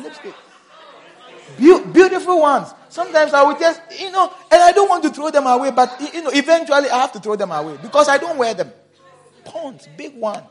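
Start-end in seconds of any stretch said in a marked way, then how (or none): 1.6-10.4 loud, high, fast preaching; full-force preaching. Acted. Pastor telling about stained shirts, loud and high. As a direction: preach it out, raised and quick.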